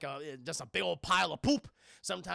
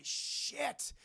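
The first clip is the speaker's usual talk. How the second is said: whispered curse; 7 semitones higher, 5 dB quieter than usual. Retold or imitated. Learning pages are in cellar.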